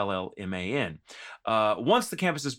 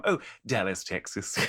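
a light mock posh Stewie accent, laughing; a little Stewie; 3 semitones lower, 4 dB quieter than usual. Mocking himself, he answers in a mild Stewie accent. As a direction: toss off Dallas in a hint of a snooty Stewie accent. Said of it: "It was a little Stewie".

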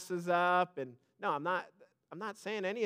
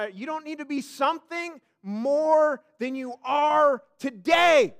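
preaching at full force; 6 semitones higher, 8 dB louder than usual. Acted. Preacher building again.

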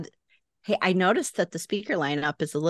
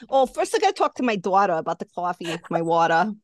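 quick Long Island "dawter, cawfee, wawter"; mock Long Island accent; faster than usual. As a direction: lay on the New York vowels. Plays along with Long Island accent.

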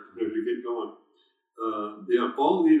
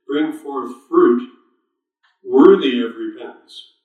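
emphatic scripture reading; 9 dB louder, slower than usual. Acted. Preacher repeats verse emphatically.

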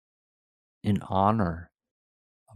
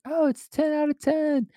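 mock gushing. Mocking gushing reviewers.

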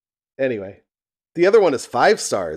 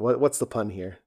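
normal talking voice

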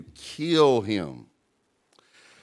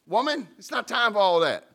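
much higher, exasperated son to his mom; high mock Jesus; 9 semitones higher than usual. Pastor voicing Jesus to his mother, high and exasperated. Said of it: "he's like".

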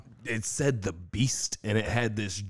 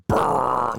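loud buzzing lip-roll noise; imitated speaker rumble; 3 semitones higher, 7 dB louder than usual. Mimicking his car's speaker system, loud buzz. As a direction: imitate blown-out car speakers with a brrr.

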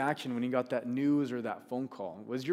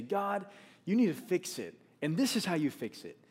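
bossy demand; 6 semitones higher than usual. Pretend. Prayer Nehemiah did not pray.

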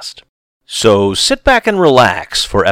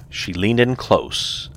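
plain reading voice

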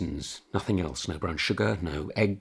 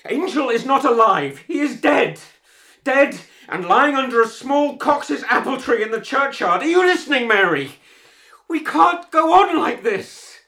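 loud, high-pitched confrontation; desperate angry shouting; 9 dB louder than usual. Acted. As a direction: force the truth on her at full volume. Husband confronts wife over dead child.

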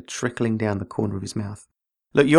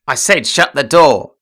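loud, slow barked command; a read-aloud shout to shut the door; shouted order; 3 semitones higher, 8 dB louder than usual. Acted. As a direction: bark it at him, drawn out. Derek snapping at Blake.

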